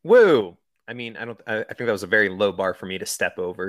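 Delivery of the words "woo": high, loud little whoop; mock cheer. Real, not acted. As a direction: cheer yourself on jokingly. Mock celebrating.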